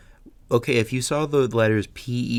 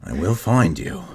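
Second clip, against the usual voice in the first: mock menacing vow of a father; Liam Neeson; 2 semitones lower, 3 dB louder than usual. Father vowing to find the kidnapper, Liam Neeson impression. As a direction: play the avenging dad, lightly menacing. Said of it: "sounds like Liam Neeson after fa-, finding out".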